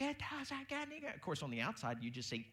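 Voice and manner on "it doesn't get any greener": mock shriek. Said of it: "we're like".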